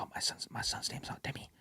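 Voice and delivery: whispered mother's answer; whispered reply. Retold or imitated. Mother whispering back in the movie theater, whisper. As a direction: breathe it out like in a cinema. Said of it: "She's like".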